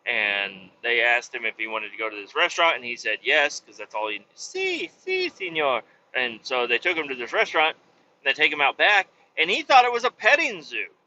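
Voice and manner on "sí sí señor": high-pitched mock Spanish reply; a hint of a put-on Spanish accent. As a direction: parrot the only Spanish he knows, squeaky. Friend's only Spanish words.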